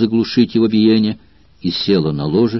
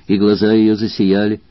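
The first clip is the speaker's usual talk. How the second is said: ordinary delivery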